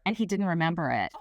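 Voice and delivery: ordinary talk, nothing marked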